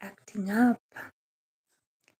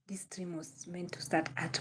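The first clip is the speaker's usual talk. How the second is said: whispered aside; 13 dB quieter than usual. Real, not acted. Whispered aside about wrong time.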